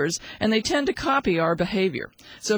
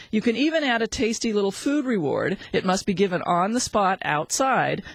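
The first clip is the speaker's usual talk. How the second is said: ordinary talk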